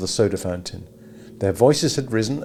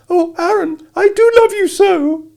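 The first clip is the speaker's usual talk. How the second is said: much higher, louder mocking falsetto; 9 dB louder than usual. A narrator's reading. A teen mocking Abra from the soda counter, falsetto. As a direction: squeak it out to mock her. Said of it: "a falsetto followed her".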